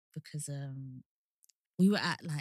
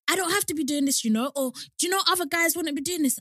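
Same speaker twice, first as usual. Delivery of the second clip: high, louder mock of a man protesting; imagined complaining man; 10 semitones higher, 5 dB louder, faster than usual. Imagining a man answering the woman's complaint, mock indignant protest. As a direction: put on his indignant protest, pitched up. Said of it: "I can imagine one of them saying".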